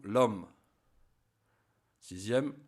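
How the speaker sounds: ordinary delivery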